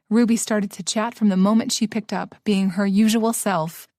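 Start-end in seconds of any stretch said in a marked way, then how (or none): none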